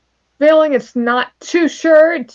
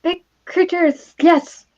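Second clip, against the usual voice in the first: higher, weird little rat voice; 6 semitones higher than usual. Acted. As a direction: answer as an odd-sounding rat. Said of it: "my rat voice just sounds really weird".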